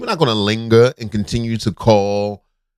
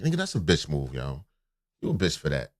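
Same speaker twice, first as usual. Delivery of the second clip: quiet insult; 7 semitones lower, 10 dB quieter than usual. Shown as a demonstration. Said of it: "You be like".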